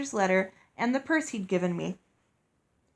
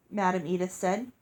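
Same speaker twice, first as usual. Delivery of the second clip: usual speaking voice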